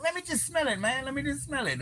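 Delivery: very high, soft stoner voice; imitating Chong. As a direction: pitch way up and ask to sniff it, softly. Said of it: "He's like".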